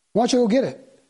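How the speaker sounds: high, loud demanding question; pushy challenge. Retold or imitated. Preacher voicing a challenge about the ark, loud and demanding. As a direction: push him to go fetch it.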